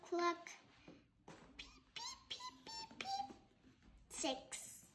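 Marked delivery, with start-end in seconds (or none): 1.6-3.3 tiny very high soft peeps; chick peeps. Acted. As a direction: peep like a chick. Child reading chick sounds.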